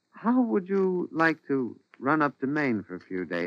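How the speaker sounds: soft, casual-sounding invitation; guarded coaxing. Acted. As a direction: coax quietly, trailing away. Husband slyly proposes a fatal trip.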